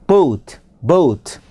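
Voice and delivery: "boat" with a gliding oh-oo vowel; "boat" with a full English O; English long O. Shown as a demonstration. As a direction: say "boat" low and falling with a rounded diphthong. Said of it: "얘는 모음도 다르지요".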